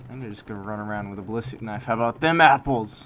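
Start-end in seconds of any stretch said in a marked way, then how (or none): 1.8-3.1 loud gloating taunt at the enemy. Real, not acted. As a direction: rub it in, louder. Taunting enemy after a kill.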